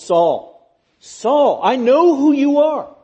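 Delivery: loud, high, penetrating call, slowing down; piercing. Acted. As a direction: pierce him with it, utterly convicting. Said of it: "that would be piercing".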